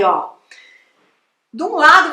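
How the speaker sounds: usual speaking voice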